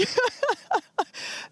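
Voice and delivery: high breathy falling laugh; giddy laugh. Real, not acted. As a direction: laugh it off, overwhelmed. Reaction after launch.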